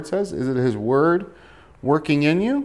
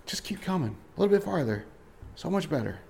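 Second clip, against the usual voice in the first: soft, higher coaxing voice; God gently coaxing; 6 semitones higher, 8 dB quieter than usual. Acted. God answering someone who says it's too hard, gently coaxing. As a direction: coax them onward lightly and quietly.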